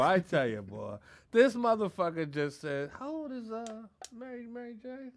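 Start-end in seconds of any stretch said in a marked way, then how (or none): 3.0-5.1 soft, higher mimicking voice; mock sheepish. Host imitates Bomb asking about Mary, mock sheepish. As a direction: imitate a hesitant, hushed question. Mimics Bomb's shy question.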